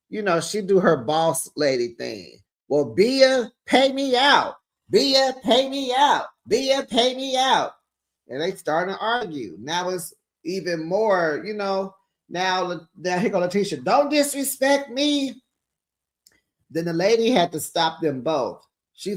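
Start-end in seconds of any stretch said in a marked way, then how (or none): 2.7-7.7 high, bossy "pay me out"; lightly voiced boss lady, higher pitched. Boss lady demanding payment. 13.8-15.4 high, slow "don't disrespect me"; imitating an offended cast member; mock indignant. Letitia argues, retold.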